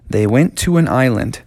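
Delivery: ordinary delivery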